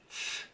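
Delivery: soft, voiceless tss hiss. Shown as a demonstration. Teacher isolating the ts sound, softly hissed. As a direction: breathe out a quiet tss with no voice. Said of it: "it's like a tss".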